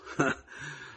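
short, high laugh; mocking laugh. Man laughs at becoming her son-in-law again, scoffing. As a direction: burst into a brief scoffing laugh. Laughs at being thought mad.

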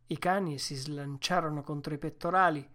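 usual speaking voice

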